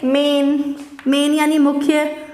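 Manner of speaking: ordinary talk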